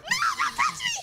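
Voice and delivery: high shrieking laugh; laughing scream. Real, not acted. Monster grabbing her.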